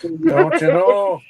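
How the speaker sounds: slow, drawn-out don't you know; hammed-up Upper Midwest drawl; Minnesota accent. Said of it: "hitting you with the don't you knows".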